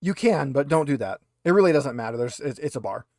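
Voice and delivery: ordinary delivery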